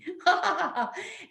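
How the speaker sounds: high, gleeful imitated laugh; high ha-ha-ha, mimicking a delighted teaser; imitated Buddha laugh. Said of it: "Just like this little Buddha laughing person".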